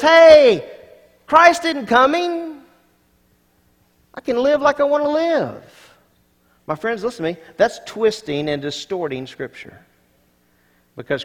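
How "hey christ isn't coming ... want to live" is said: much higher, louder, slow mocking voice; scoffing mocker. Mocker brushing off Christ's return, loud and scornful. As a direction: sneer it like a careless scoffer.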